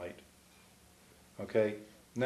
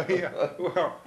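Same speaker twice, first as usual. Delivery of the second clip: laughing; 7 semitones higher than usual. Real, not acted. Laughing at the story.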